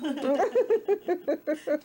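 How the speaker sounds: loud shy giggle; embarrassed laugh. Real, not acted.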